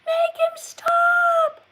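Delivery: high, loud wailing cry for mom; shrieking child calling for mom; screaming child. Retold or imitated. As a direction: wail like a little kid begging mom for help. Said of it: "You would be screaming and yelling".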